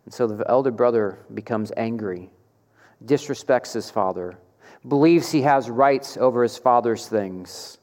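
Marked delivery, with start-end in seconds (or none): none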